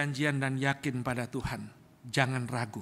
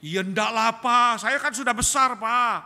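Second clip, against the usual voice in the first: put-on loud, high, dismissive teenage reply; brash son; 5 semitones higher, 10 dB louder than usual. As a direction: brush off dad loudly and impatiently. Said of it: "Ngomongnya kayak gitu". (anak membantah ayah dengan keras dan tinggi)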